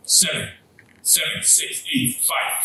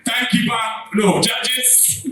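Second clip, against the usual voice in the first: higher, louder shout across the room; host shouting for the timekeeper; calling out; 7 semitones higher, 3 dB louder than usual. Real, not acted. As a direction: call the timekeeper over.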